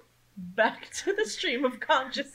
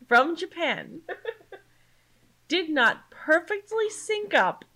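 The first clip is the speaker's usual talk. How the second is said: stretched-out comic incredulity, getting louder; incredulous rant; 10 semitones higher, 5 dB louder than usual. Real, not acted. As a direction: climb into a laughing, outraged recap. Building mock-incredulous recap of gripe.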